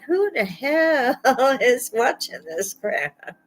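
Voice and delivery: high, slowed mock outrage at the price; half-laughing, disbelieving rant; grumbling at the price of a loaf of bread; outraged shopper. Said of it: "I'm like".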